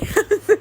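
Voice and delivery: high, quick burst of laughter; short giggle. Real, not acted. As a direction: giggle at yourself. Laughs at own Fleabag obsession.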